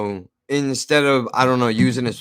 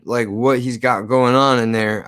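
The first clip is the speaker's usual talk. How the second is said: ordinary talk, nothing marked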